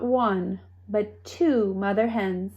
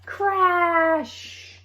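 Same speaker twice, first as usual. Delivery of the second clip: crash; 8 semitones higher than usual. A narrator's reading. Sound effect word in story.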